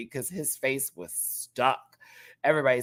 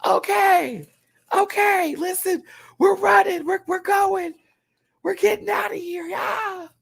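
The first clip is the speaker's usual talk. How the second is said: much higher, loud, panicked cast members rushing off; mock panic, everyone scrambling to leave; 5 dB louder than usual. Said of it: "Everybody is like".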